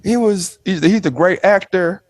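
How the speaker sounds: high, impressed gushing; impressed onlookers. Retold or imitated. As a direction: gush like an impressed fan. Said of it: "they'd be like".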